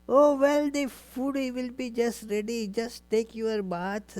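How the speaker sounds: high-pitched, easy reassurance to guests; Krishna. Acted. Krishna tells the sages food will be ready, lightly reassuring. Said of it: "said to all the sages".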